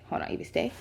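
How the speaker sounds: whispering to the dog; whispered dog command. Real, not acted. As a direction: whisper it, keep her still.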